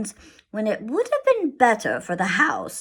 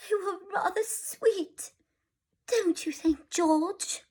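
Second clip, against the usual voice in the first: tender wistful; 11 semitones higher, 6 dB quieter than usual. Acted. Mother tender.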